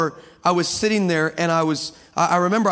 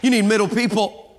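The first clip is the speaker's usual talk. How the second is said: loud, high rousing preacher climax; full-force preaching; 5 semitones higher, 4 dB louder than usual. Acted. Preacher rallying the church about middle people, loud and rousing. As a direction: drive it home to the congregation.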